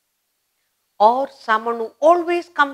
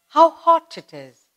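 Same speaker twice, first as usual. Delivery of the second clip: exclamation; 7 semitones higher, 5 dB louder than usual. Shown as a demonstration. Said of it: "expresses sudden, strong emotional feeling".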